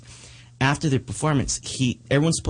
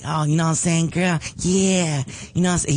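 a touch higher, lightly voiced smooth-talking guy; squeaky flirt; 5 semitones higher than usual. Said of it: "he was talking like this".

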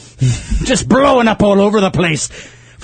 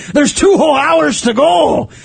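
pirate shout; 6 semitones higher than usual. Acted. Host shouting in pirate role.

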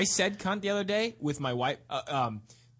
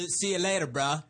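put-on English accent. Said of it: "Do it with your accent".

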